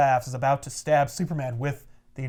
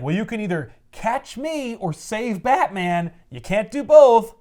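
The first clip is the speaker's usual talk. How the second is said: slow, raised, gloating taunt; villainous taunt; 6 semitones higher, 3 dB louder than usual. Retold or imitated. Falling villain taunts Superman with a choice, gloating. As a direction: draw it out as a mock villain's ultimatum.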